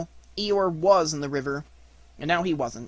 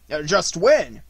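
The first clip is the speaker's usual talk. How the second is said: loud, hesitant dodge; slightly sheepish question; a little uncomfortable; 3 semitones higher, 8 dB louder, slower than usual. A narrator's reading. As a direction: stall with uneasy innocence. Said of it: "said Tigger a little uncomfortably".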